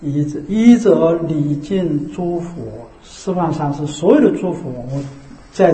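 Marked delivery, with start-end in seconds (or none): 0.0-2.6 一则离境诸佛 drawn out slowly, word by word; slow recitation. Shown as a demonstration. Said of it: "不能念得太快".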